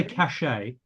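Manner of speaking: "cache" said as "cash-AY"; two syllables, "cache" ending in AY; other side's pronunciation. Retold or imitated. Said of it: "you guys say cache".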